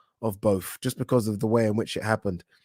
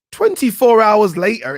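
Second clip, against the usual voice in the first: loud exasperated; 9 semitones higher, 7 dB louder than usual. Real, not acted. Mocking reversal.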